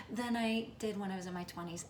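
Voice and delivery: ordinary delivery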